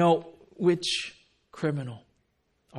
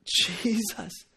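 strained, higher dying call; acted breathless effort; grasping for air; 4 semitones higher than usual. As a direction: push the name out with little breath. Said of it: "imagine you're grasping air every time".

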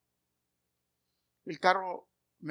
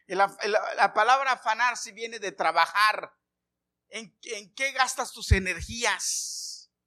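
high, loud, urgent sermon delivery; fervent preacher voice rising and pressing; preaching at full force; 5 semitones higher, 5 dB louder than usual. Acted. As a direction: drive each question home to the congregation. Preacher exhorts congregation.

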